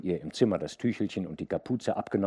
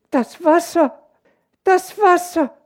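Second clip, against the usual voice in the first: sehr hoch und laut gerufen; ängstlicher Kinderruf; 13 dB louder than usual. A narrator's reading. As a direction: erschrocken aufschreien wie ein kleines Kind. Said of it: "rief sie". (ängstlich gerufen)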